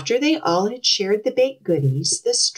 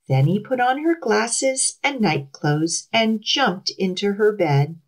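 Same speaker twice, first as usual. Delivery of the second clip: plain reading voice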